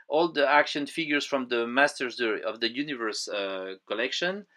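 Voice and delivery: usual speaking voice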